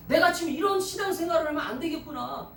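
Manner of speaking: fervent resolve. Acted. Voices a believer's resolve.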